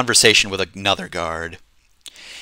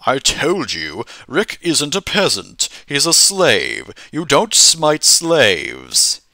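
slow, drawn-out admonishing ruler; Lord British's voice; 4 semitones higher, slower than usual. Acted. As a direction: stretch it out as a ruler laying down the law. Lord British corrects the player.

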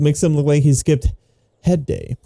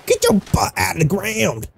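strained, higher mock-angry order; mock scolding; 4 semitones higher than usual. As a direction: playfully scold a stuck character. Mock scolding a stuck game character.

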